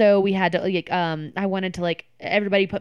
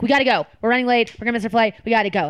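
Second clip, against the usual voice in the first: urgent, louder and higher; hurried; 4 semitones higher, 5 dB louder, faster than usual. Retold or imitated. Alex hurrying friends to catch their flight, urgent and hurried. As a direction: rush them out the door. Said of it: "it's this hurried, hurried goodbye".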